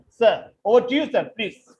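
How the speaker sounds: normal talking voice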